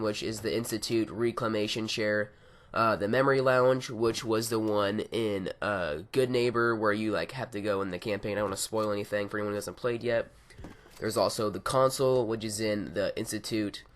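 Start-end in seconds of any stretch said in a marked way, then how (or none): none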